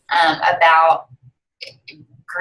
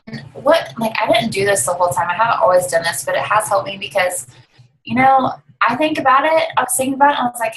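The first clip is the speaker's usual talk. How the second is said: ordinary talk, nothing marked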